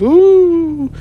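high, loud drawn-out "ooh"; mysterious "ooh". Real, not acted. Keeping home base secret, teasing mysterious ooh. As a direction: hold a playful high ooh. Playful who-knows about his home base.

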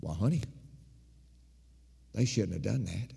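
sympathetic. Retold or imitated. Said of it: "you know what I'd say?".

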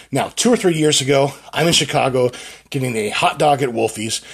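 ordinary delivery

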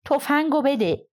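a hint of a panicked yell; shouted demand. A narrator's reading. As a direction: call out urgently for the gun. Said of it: "خطاب به کلی فریاد زدم".

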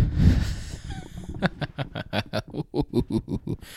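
airy, high laugh at own joke; breathy laugh. Real, not acted. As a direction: laugh at your own pun.

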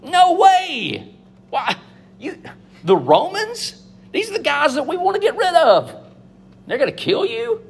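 can't believe the plan; Peter's disbelief. Retold or imitated. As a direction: Peter aghast, voice jumping up high. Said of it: "What did Peter himself say to Jesus?".

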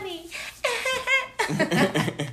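put-on slow, high, girly voice with a laugh; drawn-out giddy girl voice. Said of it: "Why am I doing a girl voice".

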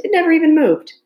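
high, loud, gleeful tease; teasing. A narrator's reading. Amanda mocking the announcer's stiff hair, loud teasing. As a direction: push it up high and loud to poke fun. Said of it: "Amanda teased".

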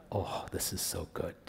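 hushed, whispered relish; very soft, low whisper; savouring aside. Real, not acted.

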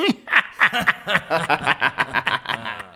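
cruel drawn-out laugh; mocking laugh. Dussasana laughing down at Draupadi, cruel and drawn out. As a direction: laugh down at her. Villain laughs after jeer.

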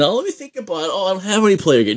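high, quick mock dithering; mock hesitant excuse. Teller mocks the excuse he refused to make, high and quick. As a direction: play the polite excuse he didn't give, high and fast. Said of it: "Not even".

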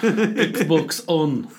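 put-on Russian accent aimed at the console, half laughing; mock Russian accent. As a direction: bark the command in a hammed-up Russian accent. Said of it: "you talk to it in a Russian accent".

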